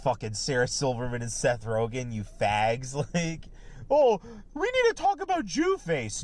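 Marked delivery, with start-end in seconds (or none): 3.9-6.2 much higher mock whiny voice; mock them in a high, whiny voice; mocking impression. Mocking quote of celebrities.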